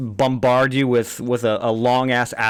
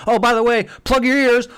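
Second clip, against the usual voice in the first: high, loud and breezy; a hammed-up breezy heroine tossing a grenade warning; mock offhand warning; 9 semitones higher, 4 dB louder than usual. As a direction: toss off "plug your ears" like an afterthought.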